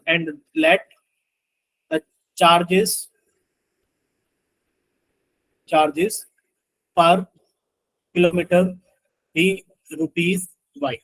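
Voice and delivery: halting, word-by-word dictation; slow dictation. Real, not acted. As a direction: spell it out slowly, pausing between words. Dictates while writing out the equation. (एक-एक शब्द रुक कर लिखवाते हुए)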